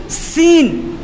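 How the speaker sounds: seen pronounced high and drawn out; letter seen articulated. Shown as a demonstration. Preacher showing where letters are articulated, seen sounded out. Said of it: "যেখান থেকে উচ্চারণের কথা".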